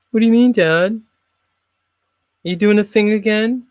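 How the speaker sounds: put-on a kid catching dad out; suspicious son. Son voiced in skit.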